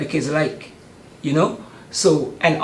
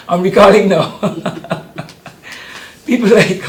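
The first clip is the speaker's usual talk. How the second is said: a real laugh bursts out, louder; 4 semitones higher, 7 dB louder than usual. Real, not acted. To the host, laughing at his own memory. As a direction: laugh through the admission.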